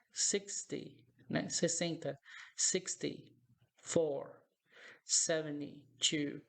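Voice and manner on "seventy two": Seventy-two said slowly with the T left out; "seveny-two", gliding from N to Y; slow Seventy-two, T swallowed; T dropped. Shown as a demonstration. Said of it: "é muito comum que a gente oculte o T".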